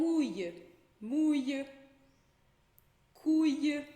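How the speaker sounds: "ouille, mouille, couille" one by one, loud, ending in Y; L como I. Shown as a demonstration. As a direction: say "mouille" as "mu-ye", punched out, no L. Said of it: "como se fosse um I". ("mouille" e "couille" fortes e pausados, L soando I)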